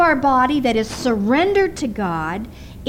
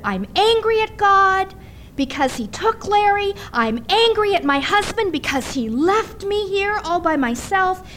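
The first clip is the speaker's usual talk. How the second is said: retold anger; 6 semitones higher than usual. Grieving widow's outburst retold.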